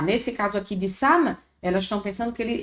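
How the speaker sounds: ordinary talk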